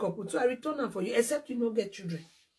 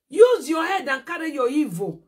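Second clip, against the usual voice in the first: angry scolding; 4 semitones higher, 5 dB louder than usual. Real, not acted. Scolding rival.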